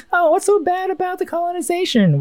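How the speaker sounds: playing a naive optimist who waves off colonization; happy-go-lucky. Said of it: "happy-go-lucky and be like".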